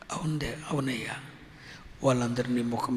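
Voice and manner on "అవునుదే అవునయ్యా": hushed, whispered assent; a meek whisper to the Father; whispered yes. Acted. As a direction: breathe the answer without voice. Son answers quietly.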